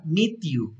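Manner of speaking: "meet you" run together as one word; words joined. Shown as a demonstration. Teacher showing how to join "meet you", linked smoothly. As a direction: link the t of "meet" straight into "you". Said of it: "lo puedes como juntar".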